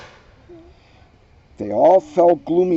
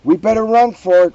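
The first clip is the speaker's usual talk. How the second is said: loud, fast urgent cry; cried out; 3 semitones higher, 8 dB louder, faster than usual. A narrator's reading. Wendell urging friends to run, loud fast cry. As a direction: shout the order to flee. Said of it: "cried Wendell".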